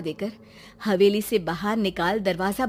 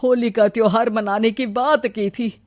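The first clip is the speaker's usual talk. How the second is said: puzzled village head; 7 dB louder than usual. Acted.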